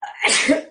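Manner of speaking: a real stifled sneeze; sneezing. Real, not acted. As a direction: sneeze turned away, muffled. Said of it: "I'm gonna sneeze".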